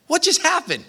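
bewildered Paul. Retold or imitated. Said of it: "Paul sat there and go".

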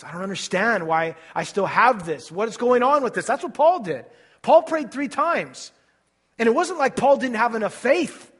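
loud, high fervent preaching; preaching at full force. Acted. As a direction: build the sermon to a loud climax.

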